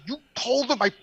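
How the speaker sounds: high, loud, indignant yelling; shouted, incredulous comic outrage; outraged confrontation. Retold or imitated. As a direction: re-enact blowing up at a girlfriend in disbelief. Retold quarrel with his girlfriend.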